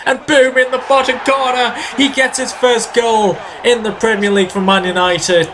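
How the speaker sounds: high, elated commentary on a goal; excited goal call. Real, not acted. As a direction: burst out high as it hits the net, then ease slightly. Celebrating Di Maria's goal.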